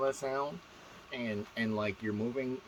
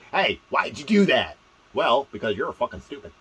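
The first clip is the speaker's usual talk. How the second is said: loud, higher mocking exchange; sarcastic character voices; 4 semitones higher, 11 dB louder than usual. Shown as a demonstration. Quoting somebody and reacting to it, loud sarcasm. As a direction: put on voices for a snide back-and-forth. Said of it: "You don't want them using the same voice".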